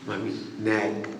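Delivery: put-on low, lisping little child asking mommy for a sweet; child begging. Child begging mother for a laddoo, babyish plea. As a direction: beg like a small child.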